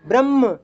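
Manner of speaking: "ब्रह्म" as short bramm; mistaken म-first form. Shown as a demonstration. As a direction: run straight into म in "ब्रह्म", no clear ह. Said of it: "तो इसको ऐसा नहीं बोलना". ("ब्रह्म" को ब्रम्म बोलें)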